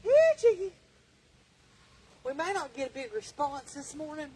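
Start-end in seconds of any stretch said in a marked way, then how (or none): 0.0-0.7 calling chickens. Real, not acted.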